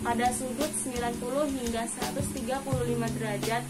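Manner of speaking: ordinary delivery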